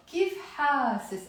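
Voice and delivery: Kif hasis, said slowly, each word clear; slow Lebanese phrase demo. Shown as a demonstration. Teacher drilling class in Lebanese Arabic, slow clear demo. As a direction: model Kif hasis slowly for students to repeat. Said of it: "Yalla, say with me out loud".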